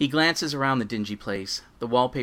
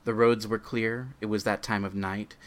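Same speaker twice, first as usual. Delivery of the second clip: normal talking voice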